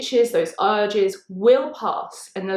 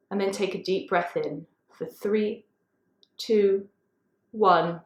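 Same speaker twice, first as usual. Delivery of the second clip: slow, low, soft counting with long pauses; guided breath count; 3 semitones lower, 4 dB quieter, slower than usual. Real, not acted. Yoga teacher counting listeners' breaths in and out, guided breath count. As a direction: count the breaths down slowly, voice dropping low on the numbers.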